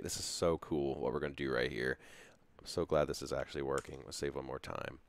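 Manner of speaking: ordinary talk, nothing marked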